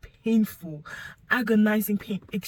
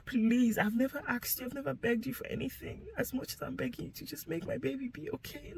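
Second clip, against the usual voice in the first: higher, mostly soft pleading; recalled plea to God, pitched up and falling soft; begging prayer; 5 semitones higher, 4 dB quieter than usual. Retold or imitated. Mother praying for her baby, begging. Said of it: "I just kept praying to God".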